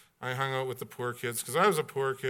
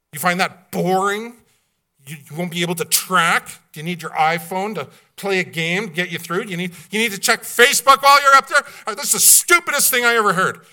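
building from louder scolding to a high, loud, fast rant; exasperated retort; 5 semitones higher, 8 dB louder than usual. Retold or imitated. Pastor scolding his friend for calling heaven boring, a building loud rant. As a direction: scold your friend like he's lost his mind. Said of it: "I said".

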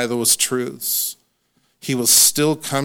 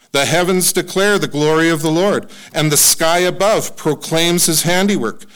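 raised, proclaiming reading of a psalm; scripture reading; 4 semitones higher, 3 dB louder than usual. Acted. Preacher reading Psalm nineteen to congregation, proclaiming. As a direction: declare it like praise.

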